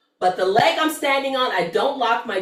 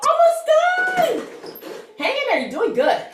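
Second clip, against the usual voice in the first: encouraging. Real, not acted. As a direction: cheer them through the last reps. Encouraging class near the end.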